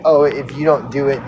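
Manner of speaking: normal talking voice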